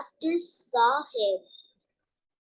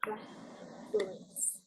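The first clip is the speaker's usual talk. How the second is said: "Blackberries" whispered very softly, just breath; whispered to herself; 17 dB quieter, slower than usual. Real, not acted. Student whispers the word to herself before answering. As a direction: mouth "Blackberries" under your breath while counting. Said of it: "Two syllables".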